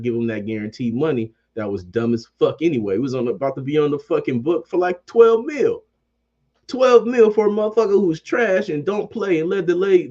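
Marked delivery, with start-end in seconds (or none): 4.8-10.1 outraged high yell. Real, not acted. Podcaster rants at his team over twelve mil, shouting. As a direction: voice leaps up in disbelief.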